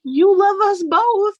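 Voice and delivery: mock toddler whine; whiny toddler plea. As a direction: whine it like a jealous child. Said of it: "it's just like".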